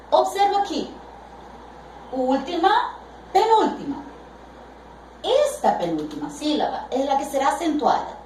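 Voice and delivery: ordinary talk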